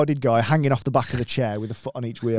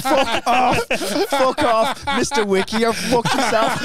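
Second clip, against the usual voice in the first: very high, hammed-up voice, breaking into laughter; accented police impression; 10 semitones higher, slower than usual. Comic retelling the starstruck police encounter, high accented impression. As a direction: squeal it in a mock accent. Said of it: "the voice or the accent".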